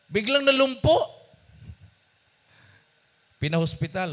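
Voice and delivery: usual speaking voice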